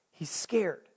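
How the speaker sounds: dropped to a whisper; hushed. Acted. Preacher whispering Gideon's fear to the congregation. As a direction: breathe it like a secret.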